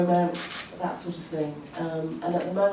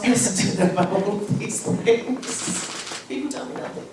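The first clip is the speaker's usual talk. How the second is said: laughing; 3 semitones higher, 3 dB louder than usual. Real, not acted. Laughing self-deprecation.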